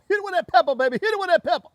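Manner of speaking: high, loud cheering on; excited hyping. Real, not acted. Cook urging on the pepper, excited high calls.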